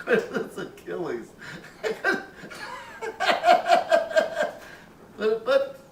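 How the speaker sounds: laughing high through the words. Real, not acted. Laughing at his childhood Achilles, high giggly.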